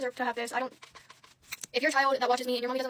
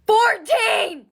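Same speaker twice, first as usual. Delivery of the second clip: Fourteen! shouted much higher and louder; shocked shout; 9 semitones higher, 7 dB louder than usual. Real, not acted. Vlogger shouting her cuss-word total of fourteen in disbelief.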